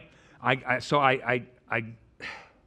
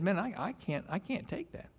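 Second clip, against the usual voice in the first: soft refusal; 7 semitones higher, 8 dB quieter than usual. Retold or imitated. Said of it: "He said".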